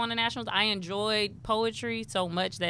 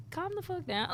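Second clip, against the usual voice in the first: exasperated retort; 11 semitones higher, 6 dB quieter than usual. Retold or imitated. Mocking overly serious poets.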